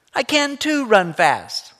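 put-on high, slow, whiny little girl's insistence; little girl protesting. Rachel protesting to her brother, high and slow little girl voice. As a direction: stretch it out like a stubborn small girl.

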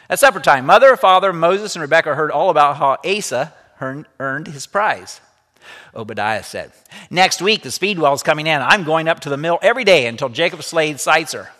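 lively read-aloud storytelling voice; story narration. A narrator's reading. Teacher reading a story to the class, lively read-aloud. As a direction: bring the story alive for the students.

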